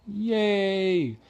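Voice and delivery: mock celebration; sarcastic cheer. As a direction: cheer without meaning it.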